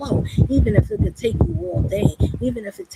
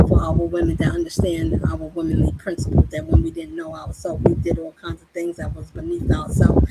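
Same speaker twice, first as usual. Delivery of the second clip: preaching; 7 semitones higher than usual. Acted. Preaching, raised pitch.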